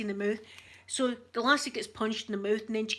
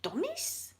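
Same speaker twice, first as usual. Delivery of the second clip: high, puzzled rising Dummies?; baffled question; 9 semitones higher, 5 dB quieter than usual. Retold or imitated. Shop staff puzzled by the dad's word, asking back high and baffled. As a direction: mimic the shop staff not getting the word. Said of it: "looking at my dad like".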